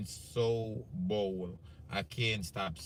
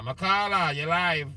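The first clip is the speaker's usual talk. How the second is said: calling out; 6 semitones higher, 7 dB louder than usual. Real, not acted. Host calling to a caller on the live show, loud and high. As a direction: call her name out loud and high.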